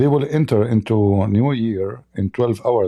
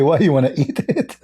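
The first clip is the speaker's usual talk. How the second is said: mocking question; 5 semitones higher than usual. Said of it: "So I told the guy".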